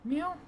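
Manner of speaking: high cat meow; imitated meow. Shown as a demonstration. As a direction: meow up high to prompt her. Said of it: "Let's do that into the microphone".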